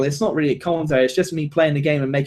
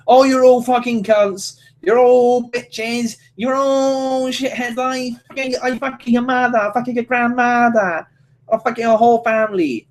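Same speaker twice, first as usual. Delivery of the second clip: mocking broken-English troll, a bit higher; toxic player insults; 5 semitones higher than usual. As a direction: spit out the insults like an angry online troll. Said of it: "People just sit there going".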